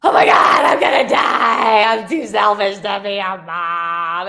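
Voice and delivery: hammed-up crying shout, re-enacting panic; mock tearful outburst. Wife bursts into tears at pregnancy test, wailing. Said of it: "I burst into tears".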